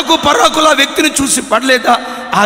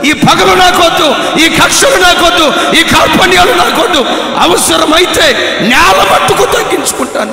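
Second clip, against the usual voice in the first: fervent shout; 7 semitones higher, 7 dB louder than usual. Acted. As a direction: cry out the vow with full force. Pastor renounces grudges, shouting. (పూర్తి ఉత్సాహంతో అరిచే ప్రమాణం)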